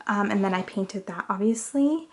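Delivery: ordinary talk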